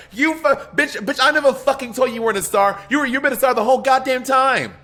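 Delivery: high-pitched indignant celebrity rant. Retold or imitated. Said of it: "I love when Ru talks like that".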